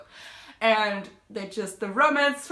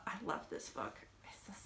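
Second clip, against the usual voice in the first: whisper; 5 semitones lower, 20 dB quieter than usual. Real, not acted. Trailing gush about the book.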